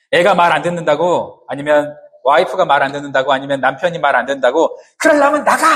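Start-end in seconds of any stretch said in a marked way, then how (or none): 5.0-5.6 높은 음으로 버럭 지르는 흉내; 말 안 듣는 식구에게 소리치듯. Acted. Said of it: "이렇게 소리 지르는 거". (버럭 화내며 소리 지르기)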